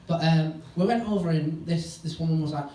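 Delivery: ordinary delivery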